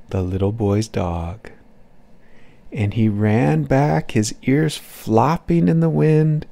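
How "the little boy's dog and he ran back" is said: ordinary delivery, nothing marked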